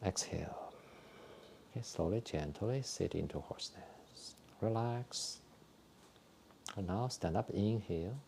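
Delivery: soft, calm breathing cues with whispered exhales; guided exercise instructions. Acted. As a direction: talk the class gently through each movement. Leads a qigong breathing routine.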